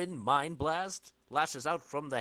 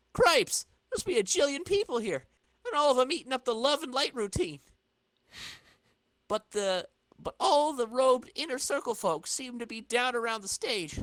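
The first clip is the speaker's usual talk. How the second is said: higher, louder astonished hero; amazed exclamation; 11 semitones higher, 7 dB louder than usual. Acted. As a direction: gasp it out in wonder. Flash amazed at crowd.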